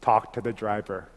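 low, quiet, firm command; a calm divine voice that settles it; God softly telling him to talk to the driver. Retold or imitated. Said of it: "I hear God say".

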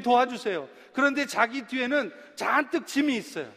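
high, loud, fervent sermon voice; a pastor pressing the point home; preaching at full force. Acted. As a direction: preach it out with rising fervor.

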